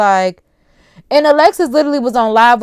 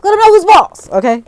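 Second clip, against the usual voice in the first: higher and louder, pushing it; mock bossy instruction; 8 semitones higher, 6 dB louder than usual. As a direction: play the tough daycare boss for laughs. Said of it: "'cause I'm like".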